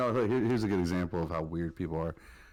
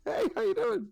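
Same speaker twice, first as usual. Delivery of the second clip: mock high greeting; 6 dB louder than usual. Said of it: "retold greeting, high".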